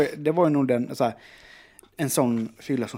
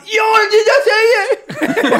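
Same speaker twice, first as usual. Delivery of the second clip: high, loud, slow tearful shout; despairing wail; 10 dB louder, slower than usual. Retold or imitated. Man desperate to be understood, hammed-up despairing wail. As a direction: howl it with a swollen tongue. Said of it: "jag är helt förtvivlad".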